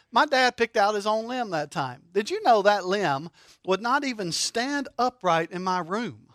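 ordinary talk, nothing marked

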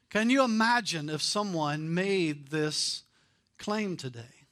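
normal talking voice

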